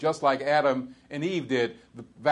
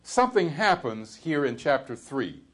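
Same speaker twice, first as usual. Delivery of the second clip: normal talking voice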